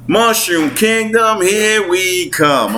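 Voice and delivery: high, slow Brooklyn twang; Brooklyn Mario. Retold or imitated. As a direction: stretch the cheer out with a Brooklyn accent. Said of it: "This is Brooklyn Mario".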